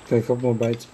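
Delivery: talking through a mouthful; mouth full. Real, not acted. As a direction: say it while chewing food. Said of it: "I can't talk with my mouth full".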